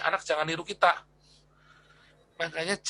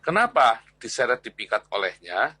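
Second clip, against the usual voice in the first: louder, ringing sermon delivery; forceful preaching; 6 dB louder than usual. Acted. Teacher opening a discipleship class preaches forcefully. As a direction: drive the scripture home from the pulpit.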